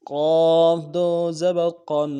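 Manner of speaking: loud, heavy قاف with a nasal tanween; موٹا قاف; heavy qaf. Shown as a demonstration. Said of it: "قاف کو موٹا کرنا ہے".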